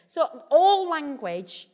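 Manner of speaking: "all" drawn out slow and loud; stress on all. Acted. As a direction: lean hard into "all language". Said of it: "slow, loud emphatic opener".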